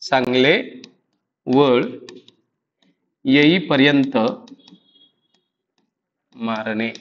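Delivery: low-pitched, word-by-word dictation. Shown as a demonstration. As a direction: leave long gaps between words, as for note-takers. Dictates the meaning for writing down.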